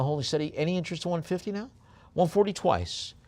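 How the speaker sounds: quick, lifted auction patter; auctioneer's call. Acted. Auctioneer calls for bids.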